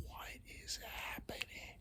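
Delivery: faint whispered panic; panicked whisper. Acted. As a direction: breathe it out, lost and scared. Imagined stoned panic.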